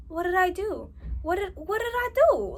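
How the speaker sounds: bewildered, asking what she did wrong; hurt confusion. Retold or imitated. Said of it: "it makes you realize".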